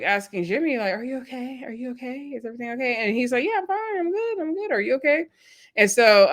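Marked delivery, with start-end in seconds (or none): 0.9-3.0 soft, higher-pitched imitation of anxious checking-in; Chelsea's concerned asking. Retold partner checking on Jimmy. 3.4-5.2 much higher mock reassurance; Jimmy's retold reassurance. Retold partner brushing off concern.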